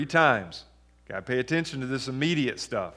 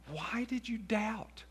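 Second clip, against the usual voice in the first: slow, hushed, higher-pitched question; soft questioning; 6 semitones higher, 11 dB quieter, slower than usual. Acted. Jesus asking Peter why he doubted, soft questioning. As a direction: ask it very softly, drawing it out.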